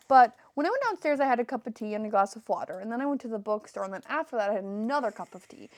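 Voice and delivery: fast, running-on explanation; rattled-off list. Retold or imitated. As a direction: reel it off quickly without stopping. Acted character rattles off her drinks.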